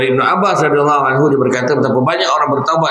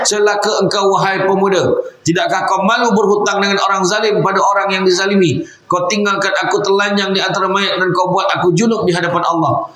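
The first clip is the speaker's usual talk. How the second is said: put-on accusing dead girl; 6 semitones higher than usual. Voicing the risen dead girl's rebuke.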